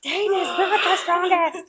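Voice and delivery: high, slow, emphatic "Dane is not the strongest"; mock insistence. Andarna insisting to Violet, high and slow. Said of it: "and she's like".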